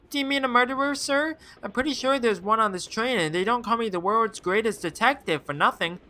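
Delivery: put-on high, bright boy voice; eager kid. Eager boy passenger answering the detective. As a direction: play an excited young boy.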